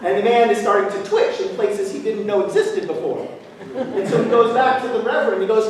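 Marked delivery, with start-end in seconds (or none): none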